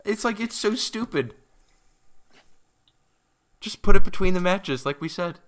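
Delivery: high, loud exasperated outburst; furious rant. Real, not acted. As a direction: yell it, fed up. Still furious about promos.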